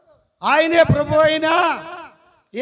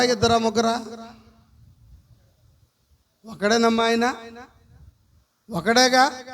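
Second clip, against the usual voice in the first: ordinary talk, nothing marked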